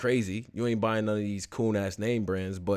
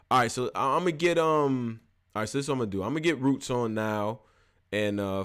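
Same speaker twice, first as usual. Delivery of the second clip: ordinary talk, nothing marked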